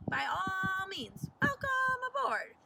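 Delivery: Captain Nemo. A narrator's reading. Captain welcomes the class aboard, grand and loud. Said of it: "said Captain Nemo".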